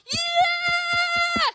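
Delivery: long, high, loud mock cheer; hammed-up shriek of approval; crowd cheer. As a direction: whoop like a roomful of excited women. Imitates ladies cheering her declaration.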